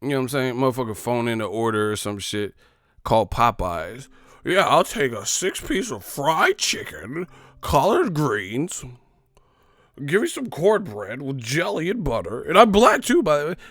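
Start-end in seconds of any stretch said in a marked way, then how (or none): 4.4-8.8 slow, lightly put-on ordering voice; a hint of a caller; mock caller voice. Earlier calmer order. 10.0-13.6 hammed-up Popeyes order; mock caller voice.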